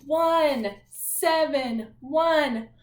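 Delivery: slow, drawn-out numbers, each one stretched; announcing a room number, slow and loud; slow room number call. Shown as a demonstration. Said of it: "when I say your class's room number".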